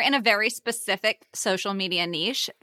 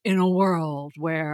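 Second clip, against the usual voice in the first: lightly voiced trailer announcer, slow; a hint of the epic trailer narrator; movie trailer voice; 5 semitones lower, slower than usual. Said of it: "the voice in the trailers".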